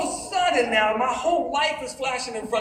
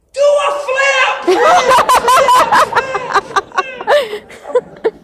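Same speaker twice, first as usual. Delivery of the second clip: loud, high egging-on shout; yelling friend; 13 semitones higher, 13 dB louder, much slower than usual. Retold or imitated. Friend yells up to the jumper on the bridge, high mock holler. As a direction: imitate a friend hollering up from below. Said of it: "my friend yells this".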